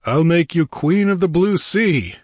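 rousing captain's voice, dropping at the end; exultant hero. A narrator's reading. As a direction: proclaim and command with gusto. Conan exults, orders crew.

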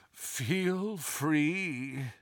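slow, soft groan of a stone creature; groaning statue. A narrator's reading. A gargoyle letting visitors up, groaning. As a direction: groan it out quietly, drawn out. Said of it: "groaned the statue".

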